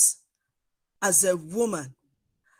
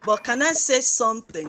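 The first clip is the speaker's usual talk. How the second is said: louder, pushing the voice out; speaking up loudly; 3 semitones higher, 10 dB louder than usual. Real, not acted. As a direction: raise the voice so the back row hears. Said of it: "Now it is".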